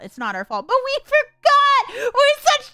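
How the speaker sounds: high, loud, dismayed wail at forgetting; dismayed outcry. Real, not acted. Player reading the game's lines aloud, loud guilty dismay.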